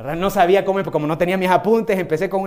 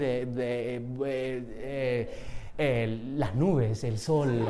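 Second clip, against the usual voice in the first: put-on mumbling, halting, tongue-tied young self; lost for words, stalling slowly and softly; a timid introvert who can't think what to say; shy fumbling; 5 semitones lower, 7 dB quieter, much slower than usual. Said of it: "no sabía qué decir".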